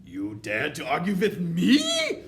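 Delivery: slow witch shriek rising high and loud; affronted witch tyrant; outraged scream. A narrator's reading. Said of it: "screamed the Grand High Witch".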